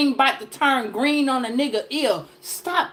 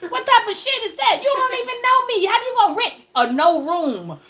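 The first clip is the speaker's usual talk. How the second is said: pitched way up, indignant; high outraged disbelief; 9 semitones higher than usual. Real, not acted. Outraged at a stranger asking to move in, high indignant. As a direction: squeal out your outrage.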